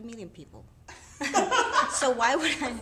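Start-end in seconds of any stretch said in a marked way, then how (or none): 1.2-1.9 short high burst of laughter. Real, not acted. Guest laughing at her own name joke, bright laugh. 1.9-2.7 louder, laughing through the words. Real, not acted. Guest laughing while defending her last name.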